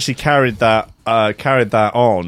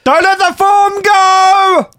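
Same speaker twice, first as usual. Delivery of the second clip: high, loud, slow coaching shout; gym trainer voice; 5 dB louder, much slower than usual. Retold or imitated. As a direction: drive her through the set. Voicing trainer coaching Hayley.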